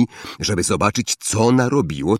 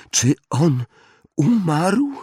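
timid voice; 8 semitones higher, slower than usual. A narrator's reading. Frightened Hermione asks.

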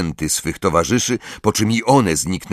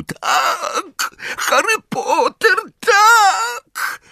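very high, drawn-out wail; howling elf; much slower than usual. A narrator's reading. Elf confirms she is coming, high howl. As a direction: howl it in a tiny elf's voice. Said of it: "Zgredek zawył".

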